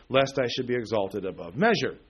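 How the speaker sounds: usual speaking voice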